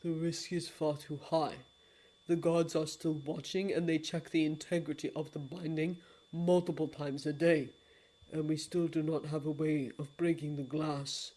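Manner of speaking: low, soft, solemn regret; a low, hushed apology; grave lord of dreams. Acted. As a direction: speak like a deep and remorseful old god.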